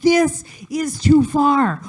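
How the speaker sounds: slow, drawn-out disgust. Retold or imitated. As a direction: recoil from the deer carcass. Disgusted at roadkill butchering.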